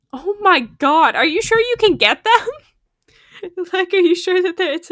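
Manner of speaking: loud, high burst of excitement; excited disbelief. Real, not acted. Gamer reacting to a lucky drop, excited. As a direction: gasp out the surprise. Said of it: "excited reaction to a game".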